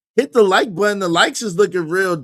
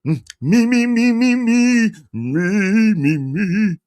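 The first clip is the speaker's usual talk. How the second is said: vocal warm-up; 3 semitones higher, much slower than usual. Real, not acted. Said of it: "I'm going in and out my voice".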